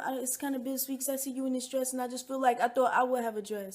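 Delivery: mock-hesitant, soft and low; hesitant confession. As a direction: imitate someone softly easing into an awkward admission. Acting out bridesmaid's hesitant bittersweet speech.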